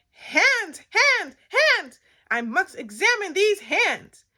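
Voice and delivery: high, loud, frantic exclamation; shrieky cartoon doctor; overexcited doctor. A narrator's reading. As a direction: cry out as a doctor wildly keen to examine. Third doctor, most excited.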